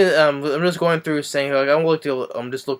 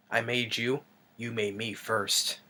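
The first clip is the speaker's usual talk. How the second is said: imitated Joker line, quieter; 8 dB quieter, slower than usual. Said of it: "he's like".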